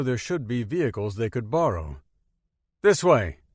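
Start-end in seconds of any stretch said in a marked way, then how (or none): 2.8-3.4 loud, firm and sure of the way; certain. A narrator's reading. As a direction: call it out with total confidence. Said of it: "he said with certainty".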